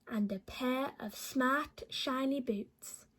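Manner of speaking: usual speaking voice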